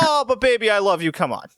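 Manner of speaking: high mock pleading; imitating Kate. Kate soothing her fiance, high mock pleading. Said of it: "her response was".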